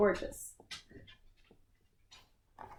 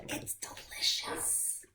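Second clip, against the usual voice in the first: slow, breathy secret whisper; 9 dB quieter, slower than usual. Acted. Narrator confiding to the children, a slow whisper. Sly aside in story.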